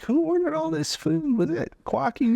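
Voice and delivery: very high, squeaky teasing voice; mock accusation. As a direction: playfully scold a stuffed toy in falsetto. Owners playfully accusing their toy koala.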